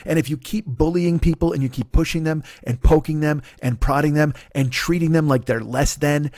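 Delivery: normal talking voice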